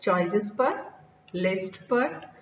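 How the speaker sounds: ordinary talk, nothing marked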